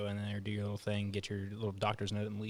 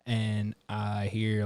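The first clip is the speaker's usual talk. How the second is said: usual speaking voice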